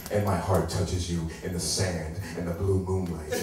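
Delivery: low, mock-romantic recital; cheesy poem. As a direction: recite bad love poetry in a deep croon. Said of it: "the cheesiest, bad, worst poem ever".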